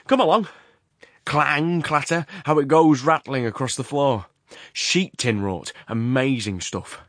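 loud, high, excited running commentary; Horrocks. A narrator's reading. The works owner hurries his companion past the mill, excitedly loquacious. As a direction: hurl out the noises of the works. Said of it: "suddenly breaking into loquacity".

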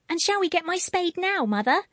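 put-on high, gentle little-girl voice; trustful, uncomplaining. A narrator's reading. Girl patiently asking mother about spade, trustful high child. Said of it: "in a trustful, uncomplaining voice".

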